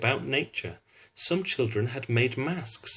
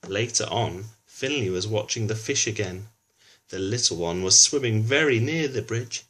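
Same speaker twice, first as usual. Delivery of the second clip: ordinary delivery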